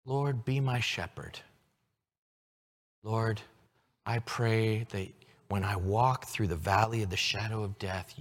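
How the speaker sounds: soft, quiet prayer voice; hushed demo prayer; spoken prayer. Shown as a demonstration. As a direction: pray it gently to the Lord. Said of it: "turn it into a prayer".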